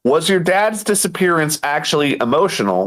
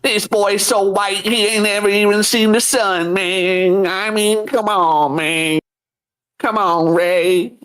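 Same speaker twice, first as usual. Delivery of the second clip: raised stand-up comedian impression; Cat Williams; 4 semitones higher, slower than usual. Comedian riffing on a pale vault boy, impression voice. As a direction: draw out the punchlines like a stand-up. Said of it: "He sounds like Cat Williams".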